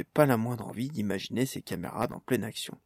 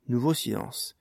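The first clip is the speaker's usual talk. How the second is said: plain reading voice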